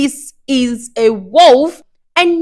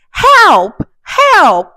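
high, loud cry for help; 10 semitones higher, 7 dB louder than usual. Acted. Grandmother waking to find the wolf, loud high cries for help. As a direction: shout out in alarm.

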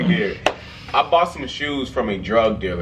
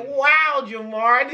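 put-on impressed girlfriend voice; 12 semitones higher, much slower than usual. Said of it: "She's like".

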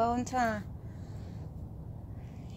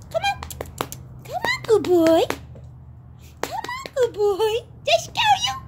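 high coaxing to a dog; pet talk; 7 dB louder than usual. Real, not acted. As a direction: coax it up high and sweet.

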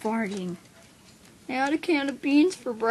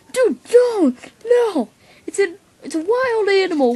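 high, loud crying plea; pleading; 8 semitones higher, 7 dB louder than usual. Acted. To his friend who wants the horse dead, a crying plea. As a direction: beg him, near tears. Begging friend not to kill.

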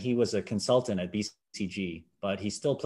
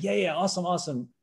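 thrilled; 8 semitones higher than usual. Retold or imitated. Thrilled reply to Larry.

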